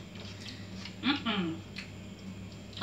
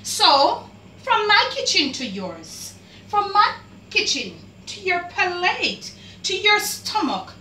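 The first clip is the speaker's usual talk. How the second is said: excited; 8 semitones higher, 3 dB louder than usual. Real, not acted. Vlogger presents her curry to viewers, voice rising high with excitement. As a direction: pitch climbing high, voice lifting with each phrase.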